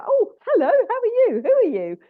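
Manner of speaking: very high, loud, rapid-fire hellos; eager animal greeting. Retold or imitated. Animal coming straight in to greet her, eager and excited. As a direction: pounce on the newcomer with excited questions.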